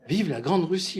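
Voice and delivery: a hammed-up toast to Russia; mock De Gaulle proclamation. De Gaulle on Red Square hails Russia, a mock grand proclamation. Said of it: "il était allé dire".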